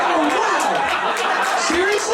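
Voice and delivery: very high, delighted disbelief; hammed-up amazement. Comedian learns of a nearby escalator, acting amazed. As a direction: squeal it in mock astonishment.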